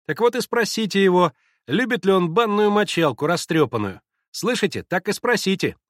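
put-on high, loud childish taunt; teasing boy. A narrator's reading. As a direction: dare him, boyishly mocking. Мальчишки дразнят мочалкой. (насмешливый мальчишеский голос)

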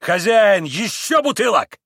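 loud, high call for the landlord; exclaimed order. A narrator's reading. Mitya orders bottles from the landlord, calling out loudly. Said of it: "восклицал Митя".

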